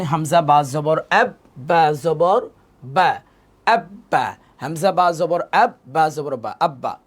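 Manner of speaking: হামজা বা জবর আব spelled slowly, আব্বা with a doubled ব; teacher's spelling demo. Shown as a demonstration. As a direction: drag out বা জবর বা, then clip আব্বা with the ব held. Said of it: "এখানে উদাহরণ দেওয়া আছে". (ধীরে বানান করে আব্বা উচ্চারণ)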